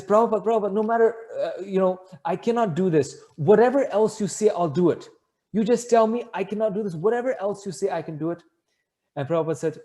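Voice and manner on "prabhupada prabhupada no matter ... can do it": high-pitched, insistent begging; retold desperate plea. Devotee offering anything else instead, desperate pleading. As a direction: act out frantic bargaining.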